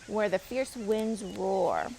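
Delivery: plain reading voice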